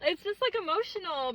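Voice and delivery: fighting back tears mid-story, laughing it off; tearing up. Real, not acted. Vlogger tearing up remembering rejection, voice catching. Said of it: "I'm gonna cry".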